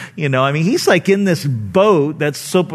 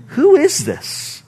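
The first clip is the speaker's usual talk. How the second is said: much higher, slow, astonished outcry; awed exclamation; 10 semitones higher, slower than usual. Retold or imitated. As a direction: cry it out in wonder. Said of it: "They looked at one another and they go".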